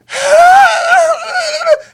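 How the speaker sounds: very high, loud shriek; hammed-up screech of pleasure; imitated climax scream. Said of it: "And they be like".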